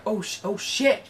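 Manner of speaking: retold dawning alarm. Viewer catching on to the bomb, hesitant alarm. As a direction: start to catch on, not yet yelling. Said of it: "when you freaked out, I kind of, uh, caught up".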